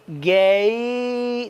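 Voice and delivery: गे held very long, "gaaay-eee"; gliding vowel. Shown as a demonstration. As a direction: stretch गे slowly from A into E. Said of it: "ए की sound भी है और ई की sound भी". (गे को ए से ई तक लंबा खींचकर)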